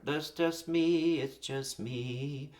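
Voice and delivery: slow, rising on me, sung-like; melody follows speech stress. Shown as a demonstration. Songwriter showing the melody in the words, slow and lilting. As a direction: stretch the words along their natural accent. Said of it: "there's a good place to start".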